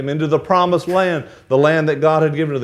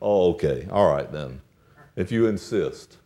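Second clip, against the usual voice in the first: much lower, softer, feigned reluctance; reluctant polite acceptance; 8 semitones lower, 5 dB quieter than usual. Retold or imitated. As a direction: give in with a sigh of politeness. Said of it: "you say".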